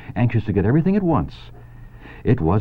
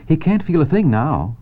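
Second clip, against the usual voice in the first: Charlotte; 9 semitones higher, 3 dB louder than usual. Acted. Charlotte voiced high.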